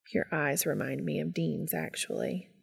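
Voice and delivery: low, soft character voice; Cass's gentle voice. A narrator's reading. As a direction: drop low and quiet, as if confiding. Voices Cass in dialogue.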